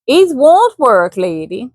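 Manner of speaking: slow Swedish refusal; a firm Swede, slow; Swedish accent. Acted. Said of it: "didn't do his accent".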